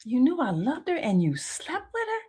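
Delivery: high, hurt disbelief; devastated reproach, re-enacted. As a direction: act out a wounded, incredulous question. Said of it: "I was devastated".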